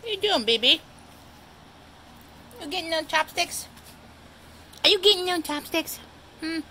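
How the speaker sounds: high cooing voice for the cat; doting owner baby-talking her old cat; pet baby talk. Acted.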